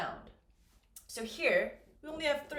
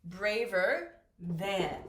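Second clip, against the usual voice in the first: Braver than said very slowly and clearly; teacher modelling Braver than slowly; slow model phrase; 4 semitones lower, much slower than usual. Shown as a demonstration. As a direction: stretch out Braver than so students hear each part. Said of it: "All we do is add an R".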